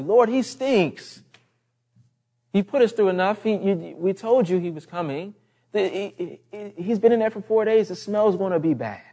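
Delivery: high, agitated objection; complaining Martha. Retold or imitated. Martha objecting at her brother's tomb, agitated complaint. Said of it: "Here comes Martha again".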